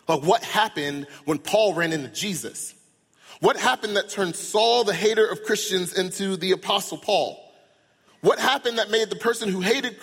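rising preacher's cry; preaching fervor. Real, not acted. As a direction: drive the questions home to the crowd. Preacher building to climax.

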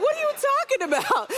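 incredulous and giggling; mock offended with a laugh; laughing disbelief. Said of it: "And I was like".

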